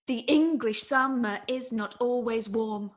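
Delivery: slow, marching on every second beat; measured iambic line; iambic sentence. Shown as a demonstration. Student trying another everyday line in verse, slow. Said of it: "Again, not great".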